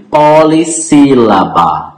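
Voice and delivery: "polissílaba" stretched into separate syllables. Shown as a demonstration. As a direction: draw out each syllable of "polissílaba". Teacher spells out the term. ("polissílaba" esticada, sílaba por sílaba)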